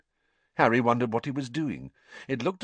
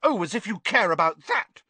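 put-on higher, scornful girl's voice; scoffing Hermione; 10 semitones higher, 5 dB louder than usual. Hermione scoffing at Ron after the kitchen. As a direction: scoff it dismissively. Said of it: "scoffed Hermione".

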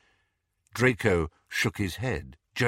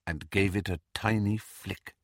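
ordinary delivery, nothing marked

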